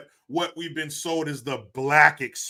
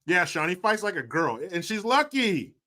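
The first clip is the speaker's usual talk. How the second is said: normal talking voice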